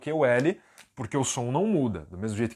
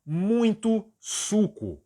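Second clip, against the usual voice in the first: "muito suco" drawn out very slowly; slow Portuguese; 7 semitones higher, much slower than usual. Shown as a demonstration. Teacher slowly posing the next Portuguese phrase. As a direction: stretch "muito suco" for the class. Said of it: "E se eu quiser dizer".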